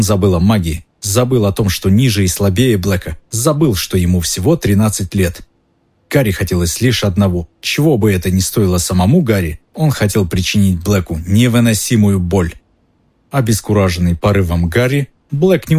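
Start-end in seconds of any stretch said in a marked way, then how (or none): none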